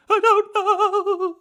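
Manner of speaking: high, loud frightened wail; terrified thug. Retold or imitated. Terrified thug answering Batman.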